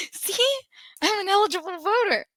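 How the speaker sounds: squeaky high mock-proud voice. Imitating herself showing her voter card, high and mock proud. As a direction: pitch it way up like a kid showing off.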